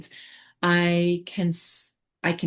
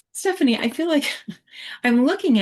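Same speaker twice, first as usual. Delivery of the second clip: laughing flustered; 8 semitones higher, faster than usual. Real, not acted. Flustered by the chat controls.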